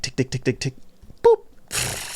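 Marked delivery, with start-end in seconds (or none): 1.2-2.2 high unvoiced boop sound; whispered boop. Imitating the cop's flashlight click, whispered boop. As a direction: pop a tiny high flashlight noise. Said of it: "he just did this with his flashlight".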